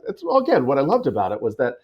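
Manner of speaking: usual speaking voice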